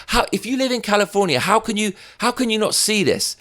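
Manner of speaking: incredulous high rant; exasperated. Real, not acted. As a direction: hammer the repeated how. Ranting at Californians.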